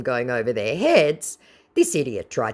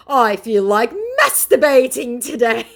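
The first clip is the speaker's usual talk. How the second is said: high, loud creepy scammer; mock scammer voice; 10 semitones higher, 6 dB louder than usual. Vlogger mocking the scammer's creepy line, high and loud. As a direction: say it high and loud, dripping with sleaze. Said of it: "he says".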